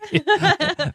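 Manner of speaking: high-pitched burst of laughter; real cackling laugh; high cackle. Real, not acted. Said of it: "listen to me cackle".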